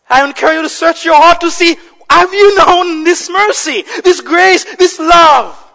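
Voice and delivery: loud, high, urgent appeal; full-force preaching. Real, not acted. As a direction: press the congregation with rising fervor.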